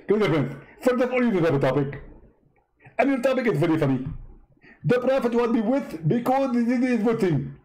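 high-pitched mock debater voice; a squeaky, smug rival preacher; Zakir Naik impression. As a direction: push the voice way up and lampoon him.

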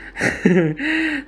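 high laugh that falls away; amused high laugh. Real, not acted. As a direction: chuckle rising into a high giggle. Laughs at own mistake.

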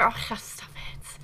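breathy whisper, slow and shy. Real, not acted. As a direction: brush off the compliment in a whisper. Bashful reply to chat.

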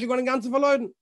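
ordinary talk

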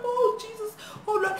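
high, whimpering mock prayer; acted terror. Girl praying after a stabbing threat, acted whimper. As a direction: whimper it as if terrified.

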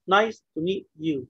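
very slow nice to meet you; slow counting read. Shown as a demonstration. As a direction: say each word of nice to meet you apart. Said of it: "One, two".

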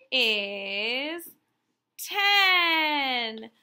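loud cheering announcement of the answer; excited reveal. Acted. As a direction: burst out with the answer like a big surprise. Teacher reveals answer to children.

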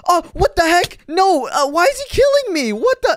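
high, loud panicked cries; frantic, voice shooting up; alarmed protest. Acted. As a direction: yelp while under attack. Being attacked by clone.

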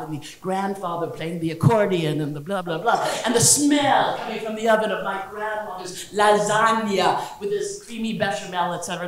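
bad Italian accent, nostalgic storytelling; lilting fake Italian cooking-show host; Giada. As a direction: reminisce in a hammy mock-Italian accent. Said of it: "bad Italian accent when I'm imitating her".

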